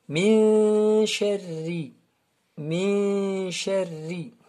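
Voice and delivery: "Min" held long with the n hidden in a nasal hum; ikhfa recitation. Shown as a demonstration. Teacher models recitation for students, hidden nun in "Min". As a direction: draw out "Min", gliding slowly into "sharri". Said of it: "Elle ne se prononce pas".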